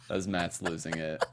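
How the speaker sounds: normal talking voice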